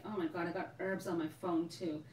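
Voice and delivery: normal talking voice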